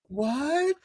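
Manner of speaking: high, stunned Southern-flavoured "What?"; playfully shocked "What?"; mock shock. Shocked reaction to the accent.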